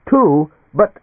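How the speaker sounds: loud, startled protest. A narrator's reading. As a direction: blurt out an objection. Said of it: "Denkmal gestured protestingly".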